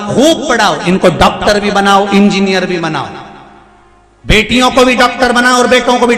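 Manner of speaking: loud, high-pitched rousing exhortation; preaching at full force. Real, not acted. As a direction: drive each appeal home to the crowd, loud and high. (पूरे जोश में ऊँची आवाज़ का प्रवचन)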